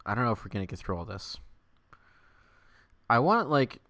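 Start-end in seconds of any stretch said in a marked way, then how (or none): none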